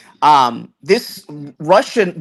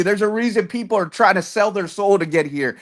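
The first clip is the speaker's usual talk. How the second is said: usual speaking voice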